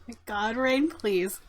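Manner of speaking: ordinary talk, nothing marked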